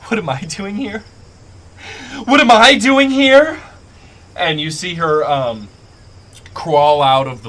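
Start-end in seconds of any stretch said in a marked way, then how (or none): 0.1-1.0 high, distressed question at normal volume; distraught. Acted. Sobbing woman answering from the bush, distraught. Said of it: "she goes". 2.2-3.6 high, very loud shriek; distraught scream. Acted. Sobbing woman bursting out at her pursuer, distraught scream. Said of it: "she goes".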